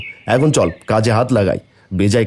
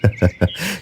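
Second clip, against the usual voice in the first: a brief amused chuckle, much softer; 2 semitones lower, 5 dB quieter than usual. Acted. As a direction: laugh gently at the child's idea. Father amused by daughter's plan.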